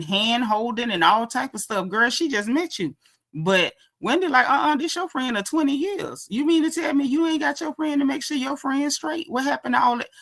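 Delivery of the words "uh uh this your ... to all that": high-pitched mock disbelief; incredulous challenge. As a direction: play Wendy calling someone out, pitched up. Acted incredulous challenge to a friend.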